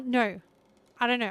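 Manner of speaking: ordinary talk, nothing marked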